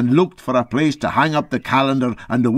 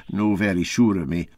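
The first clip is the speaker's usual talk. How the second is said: ordinary delivery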